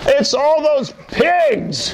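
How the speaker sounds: high slow wailing shout; upset man. Acted. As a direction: cry out about the pigs. Possessed man complains.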